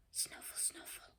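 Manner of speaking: whispered snuffling; hedgehog snuffle. Acted. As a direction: sniff it out like a little hedgehog. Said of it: "went the hedgehog".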